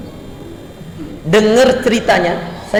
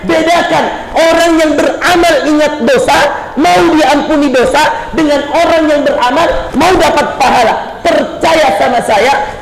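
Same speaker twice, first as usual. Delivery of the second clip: high-pitched, driving exhortation; forceful preaching; 6 semitones higher than usual. Real, not acted. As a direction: preach it in rising, insistent waves. Preaches at full force to congregation.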